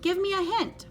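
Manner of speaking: high pleading plea. A narrator's reading. Adeline begging Elmer for a clue, high pleading voice. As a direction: coax him for a clue. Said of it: "pleaded, pleaded Adeline".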